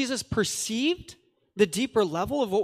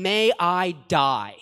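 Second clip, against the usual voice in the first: slow, louder and weighty; solemn oath; 5 dB louder, slower than usual. Acted. Preacher voicing Jesus' oath, slow and solemn. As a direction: swear it like a vow. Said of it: "the sentence reads better".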